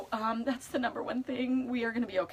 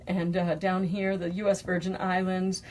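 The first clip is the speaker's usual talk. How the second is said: normal talking voice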